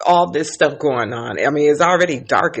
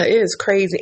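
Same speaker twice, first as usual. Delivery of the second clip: normal talking voice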